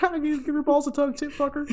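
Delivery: high-pitched, fast, mocking phone taunt; squeaky cocky jerk needling his rival; smug rival on phone. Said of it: "And he's like".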